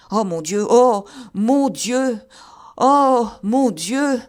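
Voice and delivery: terrified moan to God; anguished wail. Acted. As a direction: drag out each cry in horror. Horrified moaning climax.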